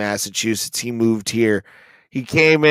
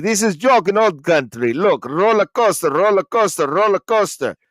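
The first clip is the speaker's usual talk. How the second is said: put-on higher, broken-English Russian accent; 6 semitones higher than usual. Open mic comic telling his old-country joke in a Russian accent. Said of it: "did the Russian accent".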